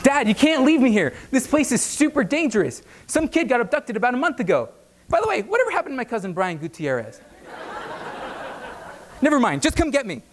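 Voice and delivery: high, frantic begging; panicked pleading. Retold or imitated. Drunk son begging Dad not to leave him, high and frantic.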